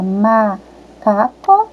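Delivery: "macaco" broken as "ma... ca-co"; teacher sounding out "macaco" in pieces; split into syllables. Shown as a demonstration. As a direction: stretch and separate the first syllable of "macaco". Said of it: "o primeiro pedacinho que sai da nossa boca".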